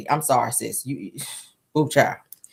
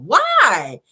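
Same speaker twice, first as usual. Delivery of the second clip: normal talking voice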